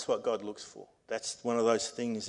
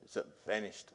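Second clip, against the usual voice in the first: ordinary talk, nothing marked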